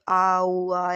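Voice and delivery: put-on drawn-out cooing vowels like a small baby; demonstrated baby coo; baby cooing imitation. Shown as a demonstration. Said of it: "ऐसे बच्चे करते हैं".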